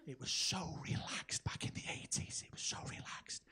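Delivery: soft nostalgic whisper. Acted. Comic to crowd, a soft wistful whisper. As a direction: hush it like a fond secret.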